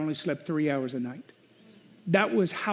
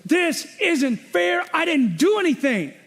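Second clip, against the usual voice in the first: high, loud, slow protest; retold yell; 9 semitones higher, 4 dB louder than usual. Pastor recalling yelling at the Lord, high slow protest. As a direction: yell at God that it's unfair, drawn out. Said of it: "And I remember yelling".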